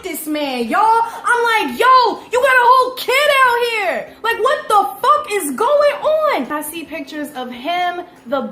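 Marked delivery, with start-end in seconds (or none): 1.8-6.5 higher and louder, stunned outrage; shocked disbelief. Retold or imitated. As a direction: blurt it out in shock. Shocked at discovering his kid.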